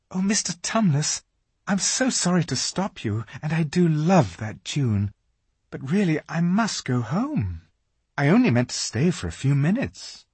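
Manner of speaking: put-on a girl gently excusing herself; polite young girl. A narrator's reading.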